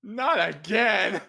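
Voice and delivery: loud, high, drawn-out exclamation; dismayed outburst. Real, not acted. Player reacting to an incoming spell, exasperated shout.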